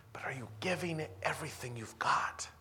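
sudden hushed challenge, breathy and intimate; dramatic whisper. Real, not acted. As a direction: drop to a whisper to confront the crowd. Preacher drops to a challenge.

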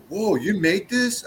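imitating a friend blown away; amazed friend.

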